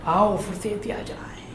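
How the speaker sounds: soft, measured poem recitation with long pauses; hushed, solemn verse reading; poem recital tribute. Acted. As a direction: recite it gently as a tribute, swelling midway, sinking almost to a hush. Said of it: "भावांजलि उनकी पंक्तियों से मैं देना चाहता हूं".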